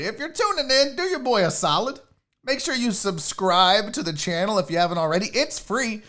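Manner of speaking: normal talking voice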